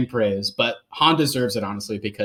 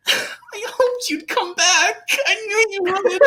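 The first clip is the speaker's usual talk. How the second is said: high, slow, tearful whimper; mock Luke Skywalker's teary, whining gush; whiny Luke; slower than usual. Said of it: "that's how he says it too".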